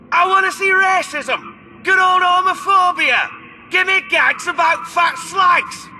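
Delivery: high-pitched, chanted demands; rowdy heckling. Acted. Heckler demanding crude gags from the act, rowdy high chant. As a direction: holler it like a boorish audience member.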